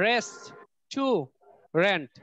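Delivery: "রেস্ট, টু, রেন্ট" in three separate beats; syllable by syllable. Shown as a demonstration. Teacher asking students to count syllables, "রেস্ট, টু, রেন্ট" split apart. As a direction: break it up with a pause after each of "রেস্ট, টু, রেন্ট". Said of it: "কয়টা syllable হয় দেখো তো".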